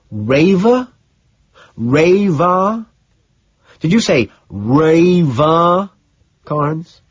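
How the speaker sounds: high, loud, rising incredulous questions; wide-eyed disbelief. A narrator's reading. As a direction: repeat it in mounting, drawn-out astonishment. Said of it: "I'm wide-eyed, feeling wired".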